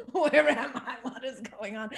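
laughing through mock bewildered questions; laughing confusion. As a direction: play lost and baffled while cracking up. Acting waking up in Tyson's body.

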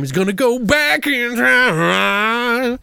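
high, hugely drawn-out words; mock time-travel drama. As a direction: stretch it like a silly sci-fi effect.

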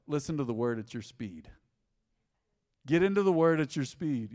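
ordinary delivery

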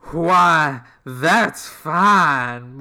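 loud Southern drawl on why that's fine; broad Southern drawl, belted out; Southern accent. Acted. Podcast host reading McCoy's line in a Southern accent. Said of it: "His accent had moved considerably south".

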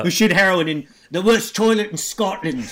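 loud, hammed-up bad Scottish accent; terrible Scottish accent. Co-host riffing on the train spotters movie, terrible Scottish accent. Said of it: "That's a terrible accent".

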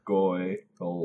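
"goyle" alone as the second syllable; isolated "goyle" for someone to repeat; syllable-by-syllable demo. Shown as a demonstration. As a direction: coach just the "goyle" of gargoyle, with oy. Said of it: "It's pronounced gargoyle".

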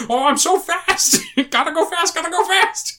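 very high, squeaky comic voice; mock frantic runner. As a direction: squeal it like a silly cartoon sprinter, giggling. Mocking running character, laughing.

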